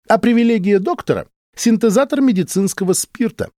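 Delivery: a touch of sly pride; smirking. A narrator's reading. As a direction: top his boast with a smirk. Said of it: "ухмыльнулся Соколовский".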